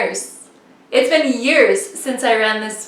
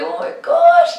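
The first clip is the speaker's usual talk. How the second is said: very high, squeaky mock dismay; exasperated retold dismay. Racer stuck at the very back, exasperated.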